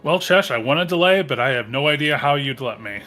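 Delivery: ordinary delivery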